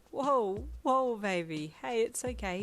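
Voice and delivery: startled but soothing; alarmed reassurance. A narrator's reading. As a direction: jump in to calm a crying partner. Alarmed partner soothes sudden crying.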